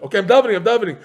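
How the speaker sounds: exasperated yell of I'm davening; mock exasperated. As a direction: snap back, fed up with being told. Retort to being told daven.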